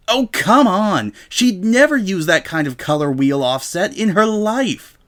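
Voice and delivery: exasperated outburst. Acted. Rainbow fuming at a knockoff dress, exasperated outburst. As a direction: scoff at an obvious fake, exasperated.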